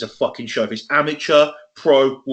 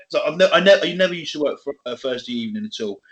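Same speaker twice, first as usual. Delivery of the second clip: ordinary delivery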